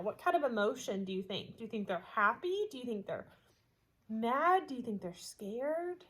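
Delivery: ordinary delivery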